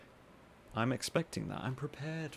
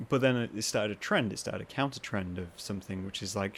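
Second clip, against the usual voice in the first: normal talking voice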